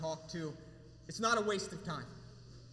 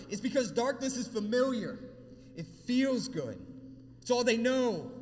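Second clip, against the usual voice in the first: raised, fervent sermon delivery, higher and louder; preaching; 4 semitones higher, 4 dB louder than usual. Acted. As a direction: preach it out to the congregation. Preaching at full force.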